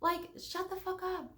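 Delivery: high, strained "shut the fuck up"; fed-up squeal; exasperated high-pitched snap; exasperated high outburst. Real, not acted. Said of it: "two times the volume".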